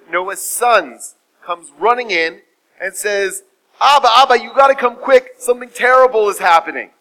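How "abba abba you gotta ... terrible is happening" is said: high, loud cry for father; urgent alarm. Acted. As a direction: raise the alarm, breathless urgency acted out. Son runs in with alarm.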